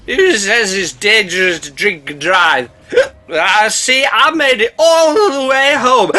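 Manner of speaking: put-on slow, high, slurred drunk voice with a laugh; tipsy boat bragging. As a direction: ham up a happy drunk.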